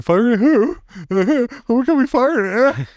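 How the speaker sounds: put-on a dim kid's baffled squeak; high confused kid voice. Retelling the game, the kid asks who to shoot, high and baffled.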